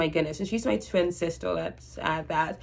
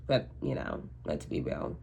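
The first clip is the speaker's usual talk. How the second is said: soft unvoiced murmur; whispered aside; 12 semitones lower, 10 dB quieter than usual. Real, not acted. Telling viewers the truth, in a resigned whisper. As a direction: drop to a near whisper, resigned.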